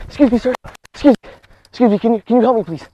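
high, loud, urgent plea; pretend panic. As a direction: beg a stranger for help, hammed-up urgency. Posing as escaped prisoner seeking help.

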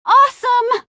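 loud, high-pitched cheer; thrilled exclamation. Acted. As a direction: burst out in high excitement. Exclaiming in action scene.

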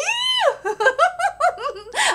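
high, drawn-out villain cackle; evil cackle. Acted. Mocking the viewer with a high evil cackle.

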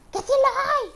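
high, loud alarmed cry; high startled shout of alarm. Real, not acted. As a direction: caught off guard, yell it out. Enemy spotted after saying nobody.

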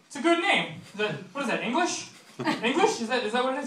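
put-on high-pitched, puzzled old man voice; old landlord. Old landlord puzzling over a new name, high-voiced. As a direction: pitch it up, jumping on each question. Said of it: "my landlord is really old".

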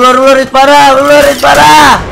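loud urgent shouting; shouting callouts. Real, not acted. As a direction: yell the warning to teammates.

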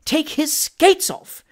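very slow, high, emphatic command; firm. A narrator's reading. Indignant gentleman overruling the skater, firm and drawn out. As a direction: spell out the order firmly, word by word. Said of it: "repeated Mister Pickwick firmly".

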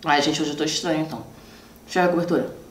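chewing between words; talking with food in mouth; mouth partly full. Real, not acted. Seen 2: bit the chocolate dessert seconds before, still chewing while speaking.

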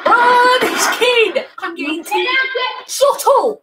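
high, loud exasperated yelling; furious yell. Real, not acted. Streamer losing it at a kid screaming down the mic, furious yell.

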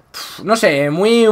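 normal talking voice

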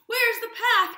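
high, loud toad in a panic; panicked cry. A narrator's reading. Toad lost under melting ice cream, panicked cry. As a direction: cry out high, at full volume. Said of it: "cried Toad".

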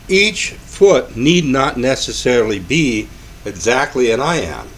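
normal talking voice

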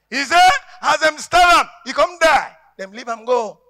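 ordinary talk, nothing marked

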